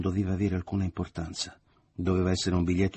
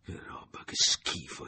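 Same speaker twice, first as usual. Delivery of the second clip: half-whispered disgust; disgusted mutter; slower than usual. A narrator's reading. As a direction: mutter it under your breath, revolted. (borbottio disgustato a mezza voce)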